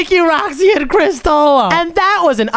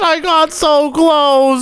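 ordinary talk

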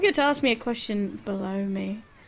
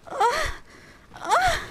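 demo moan. Shown as a demonstration. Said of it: "you mean like".

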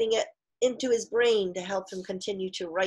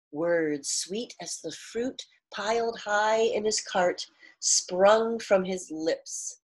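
read-aloud storytelling voice, cart drawn out slowly; story narration; 5 semitones higher, slower than usual. A narrator's reading. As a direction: read the line as part of the story. Reading the line within the story.

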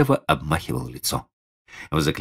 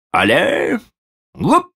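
high, loud ringmaster calls; booming circus ringmaster; 4 dB louder, slower than usual. A narrator's reading. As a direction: shout the jump cues. Circus trainer calling.

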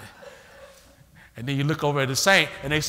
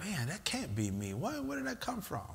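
soft puzzled inner voice; 3 semitones higher, 11 dB quieter than usual. Retold or imitated. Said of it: "And you're like".

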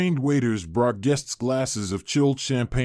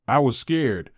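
ordinary delivery

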